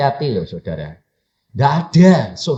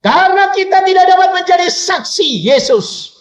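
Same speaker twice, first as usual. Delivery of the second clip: very high, loud declaration; full-force preacher's cry; preaching shout; 6 dB louder than usual. Real, not acted. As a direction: fire the answer at the congregation. Preacher answers own question with force.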